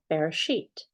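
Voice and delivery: Bereshit said "beh-reh-SHEET"; Hebrew pronunciation. Shown as a demonstration. Narrator giving Genesis its Hebrew title, Bereshit as "beh-reh-SHEET". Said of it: "the book of Genesis was called".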